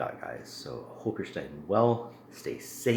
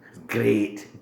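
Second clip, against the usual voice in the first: loud, hammed-up Scottish accent; 5 semitones higher, 10 dB louder than usual. Praising his stout to viewers in a mock Scottish accent. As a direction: boom it out like a proud Scotsman. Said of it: "I apologize for the poor accent".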